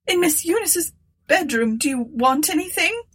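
high-pitched reply from another room; old woman calling. A narrator's reading. Martha answers Tabitha from the bedroom, calling out.

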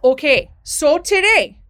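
loud, drawn-out, hammed-up Minnesota accent; broad Minnesota vowels, slow and projected; full-scale Minnesota accent. Shown as a demonstration. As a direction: lay on a thick Minnesota teacher accent. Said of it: "in my full-scale Minnesota accent".

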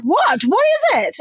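retold alarm, jumping high; retold panic, voice leaping up; panicked what. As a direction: squeak out a frightened question. Retelling hearing bad news.

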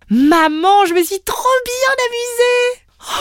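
high, loud excited greeting; excited child. Acted. Rosalie runs to her mother, excited squeal. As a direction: squeal with joy at seeing mum.

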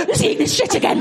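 high, horrified thought voiced aloud; high panicked inner voice; mock panic. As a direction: squeak it out in mock horror. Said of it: "I'm like".

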